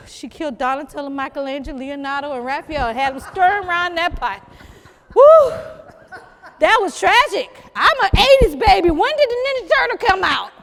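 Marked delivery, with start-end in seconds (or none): none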